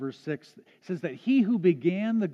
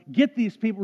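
angry outcry; 4 semitones higher, 6 dB louder than usual. Retold or imitated. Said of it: "crying out in anger".

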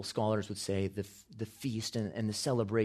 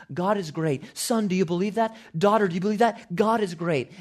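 loud, high festival cry; joyful proclaiming; 9 semitones higher, 7 dB louder than usual. Acted. Parent at the feast calling to son and daughter, jubilant proclaiming. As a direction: call it out to son and daughter, exulting. Acted festival crowd rejoicing.

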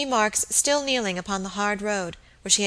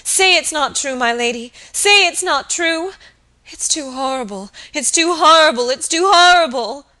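high, loud, mounting cry; horrified pleading; 7 semitones higher, 8 dB louder than usual. A narrator's reading. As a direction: beg wildly, appalled. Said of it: "she cried".

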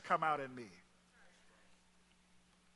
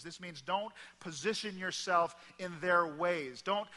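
normal talking voice

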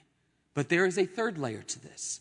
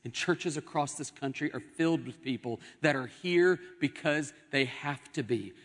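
ordinary talk, nothing marked

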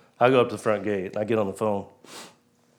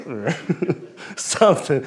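laughing; 6 semitones higher, 5 dB louder than usual. Real, not acted. Laughs about running him over.

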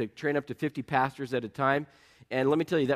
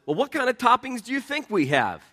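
snide, higher mock voice of a rude clerk; rude shift manager; 9 semitones higher, 4 dB louder than usual. Pizza shift manager snapping at a caller, snide mockery. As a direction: imitate a sarcastic pizza manager. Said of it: "his response is".

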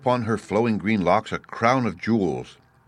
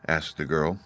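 ordinary delivery

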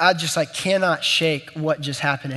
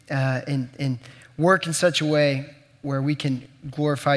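prayer; 3 semitones lower than usual. Real, not acted. Opening prayer before the sermon.

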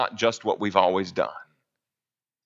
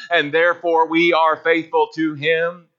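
usual speaking voice

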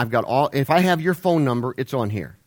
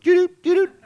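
phone chime imitation; 5 dB louder than usual.

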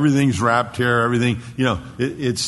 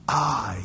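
"I" isolated in a breath-heavy hush; emphatic I; 3 semitones higher than usual. Shown as a demonstration. Preacher voices God's emphasis on himself, hushed "I". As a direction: let "I" stand alone, hushed and weighty. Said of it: "God puts the emphasis on himself".

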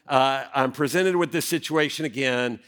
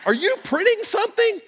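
much higher, squeaky disbelief; incredulous question. Retold or imitated. Husband quizzing his wife about the printer, incredulous and high. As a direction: ask it as if you can't believe it. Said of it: "I'm like".

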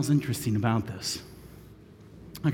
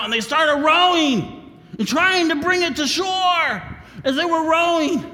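higher, louder telling; straining shout; 12 semitones higher, 5 dB louder than usual. A narrator's reading. Preacher tells the rowing, shouting. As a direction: pull hard on the oars. Storytelling climax.

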